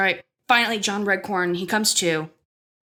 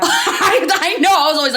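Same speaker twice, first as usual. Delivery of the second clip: loud, high, through real laughter; laughing agreement; 9 semitones higher, 7 dB louder than usual. Real, not acted. Friend admitting the attraction, laughing out loud. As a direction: agree while cracking up.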